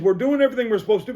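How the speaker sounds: defensive. Retold or imitated. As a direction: rattle off the excuse defensively. Nurse defending herself.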